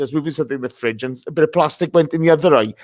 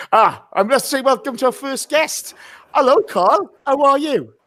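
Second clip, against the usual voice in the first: cheery high greeting; 11 semitones higher than usual. Real, not acted. Host greets first guest.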